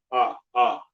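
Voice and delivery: ah, an open drawn-out vowel; the ah sound. Shown as a demonstration. Teacher isolating the vowel for the class. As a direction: hold the open ah slowly. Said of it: "The A sound is ah".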